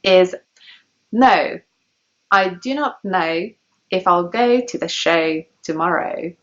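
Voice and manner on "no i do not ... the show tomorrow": slow, every O in NO, KNOW, GO, SHOW as "eh-oo"; received-pronunciation O. Shown as a demonstration. Teacher models a practice sentence, slow RP "eh-oo" in each O. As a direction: front each O in KNOW, GO, SHOW, TOMORROW, spoken slowly. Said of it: "example sentence I found to practice this sound".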